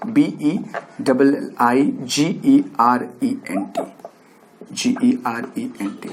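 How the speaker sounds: letter-by-letter spelling. Shown as a demonstration. Teacher spells the new word for students, spelling out. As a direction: spell it out for a class, each letter separate. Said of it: "कैसे pronounce करेंगे".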